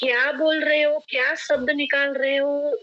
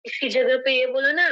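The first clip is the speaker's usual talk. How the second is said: ordinary talk, nothing marked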